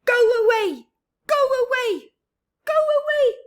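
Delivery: high, loud shrieking go away; go-away bird shouting. Acted. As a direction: shriek it at every visitor.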